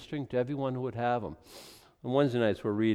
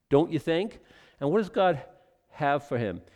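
usual speaking voice

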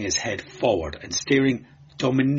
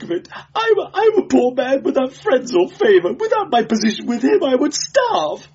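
high, tearful, pleading whimper; 4 dB louder than usual. A narrator's reading. Beaten clerk confessing to the inquisitor, sobbing whimper. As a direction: whimper it out, growing more desperate. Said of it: "whimpering like a beaten dog".

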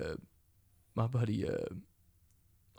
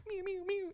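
high squeaky meow zaps; silly laser noises; 5 dB quieter than usual. Retold or imitated. As Cyclops blasting corner creatures, squeaky meow zaps. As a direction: fire off goofy pew-pew meows. Zapping imaginary creatures with eyes.

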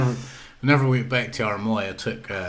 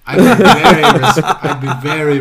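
loud, high burst through laughter; laughing protest; 3 semitones higher, 12 dB louder than usual. Real, not acted. Defending himself as a guest to teasing friends, laughing. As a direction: protest while cracking up.

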